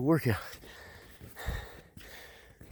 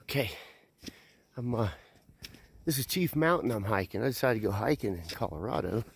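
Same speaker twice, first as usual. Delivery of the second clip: usual speaking voice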